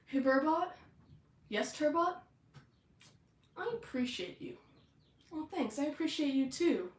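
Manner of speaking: high, put-on earnest bro voices; hammed-up bro sincerity; supportive tough bros. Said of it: "tough bro guys".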